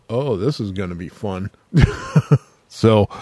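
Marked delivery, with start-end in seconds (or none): 0.1-1.5 soft, low gleeful aside; quiet delight. Retold or imitated. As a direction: murmur it with private glee. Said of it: "I was like".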